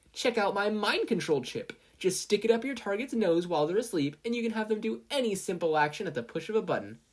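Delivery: high, cheery infomercial voice; gleeful ad pitch. Acted. Mina advertising her chip to investors, gleefully. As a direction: sell the gadget like TV shopping.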